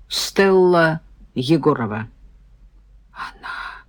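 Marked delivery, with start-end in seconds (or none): 3.1-3.8 barely audible murmur; faint, breathed-out word; stunned mumble. A narrator's reading. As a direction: mumble it almost without voice. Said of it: "забормотала я".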